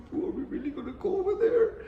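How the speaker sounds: very high, panicky squeal; shrill, frightened falsetto wondering if they really have to go; scared friend. Retold or imitated. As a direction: imitate a nervous friend begging not to go. Scared friend balks at the fight.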